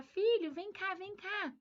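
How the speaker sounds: high, loud call from the window; mother calling her son outside, a playful high call; calling out to child. Retold or imitated. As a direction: call the child over to see the moon. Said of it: "Chamar na janela e falar".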